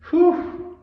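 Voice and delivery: high loud blowing whew; relieved whew. Real, not acted. After cursing Netanyahu, a high loud whew of release. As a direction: let the steam out after the rant. Exhale after angry outburst.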